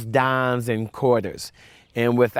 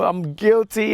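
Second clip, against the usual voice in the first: retold crying; 9 semitones higher than usual. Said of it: "immediately I started crying".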